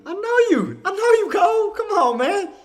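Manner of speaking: sing-song it at a buddy; teasing high voice. Real, not acted. To his buddy Cole, high teasing voice.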